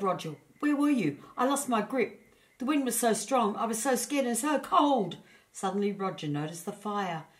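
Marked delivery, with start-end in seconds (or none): 0.6-5.2 high, small frightened voice of a hedgehog; a little hedgehog, shaken and cold after the storm; small scared hedgehog. A narrator's reading. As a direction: tremble it out like a tiny cold creature.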